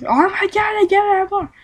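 high gushing mock voice; mocking pancake fan. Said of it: "but I'm like".

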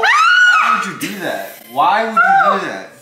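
loud, high protest; loud, high-pitched sibling complaint; exasperated outcry. Real, not acted. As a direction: cry out in disbelief, laughter close by. Reacting in a frosting squabble.

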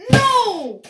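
loud high scream of dismay; shouting no. Real, not acted. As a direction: yell it out in despair. Dies in the game.

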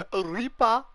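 high call across the map. Real, not acted. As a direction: shout his name to get his attention. Calling out louder, higher.

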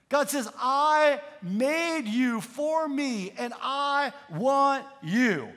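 slow, weighty sermon delivery; emphatic preaching. Real, not acted. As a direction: preach it slowly, leaning on each word.

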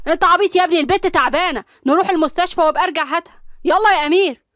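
furious urging to drive now; exasperated shout. A narrator's reading. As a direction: yell at him that she's sick. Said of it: "وقال بنرفزة".

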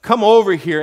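Goliath yelling out a challenge; yelled threat. A narrator's reading. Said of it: "Goliath yelled".

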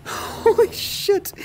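slow, laughing in relief; breathless laugh. Real, not acted. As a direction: laugh it out after the scare. Relieved laugh after the scare.

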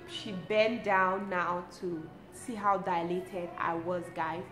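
ordinary talk

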